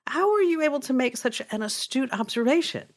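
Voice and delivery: high-pitched, impressed question; acted wonder at a trainee's cleverness; amazed. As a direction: ask it in admiring disbelief. Amazed policeman questions third trainee.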